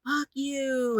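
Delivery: high, retold furious curse; angry curse. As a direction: spit out the demon's curse, imitating. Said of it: "And he was that angry".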